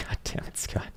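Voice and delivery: breathy whispered mock curse. Real, not acted. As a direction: hiss it under your breath. Reacts to viewer's chart.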